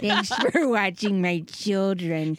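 hammed-up celebrity impression. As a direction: stretch every word like a stagey grande dame. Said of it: "is that [name]-, [name]?".

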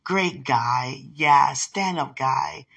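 ordinary talk

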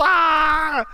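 high, loud mock scream of pain. Retelling the crucifixion scene, letting out a high scream. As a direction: scream as the nails go in. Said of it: "And he's just".

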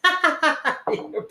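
short amused high laugh. Real, not acted. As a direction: burst of laughter at herself. Laughs at own reaction.

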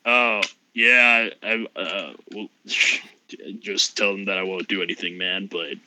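a sleepy Aussie voice; Australian Alpha Chad. Acted. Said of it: "became Australian".

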